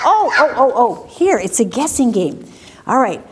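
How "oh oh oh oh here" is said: ordinary talk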